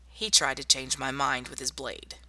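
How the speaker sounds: ordinary talk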